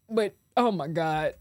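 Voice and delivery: ordinary talk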